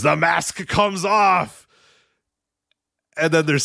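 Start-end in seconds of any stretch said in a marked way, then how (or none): 0.0-1.7 slow booming announcer shout; Stu the commentator. Retold or imitated. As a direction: stretch it out like a dramatic ring call. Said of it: "Stu's voice or whatever".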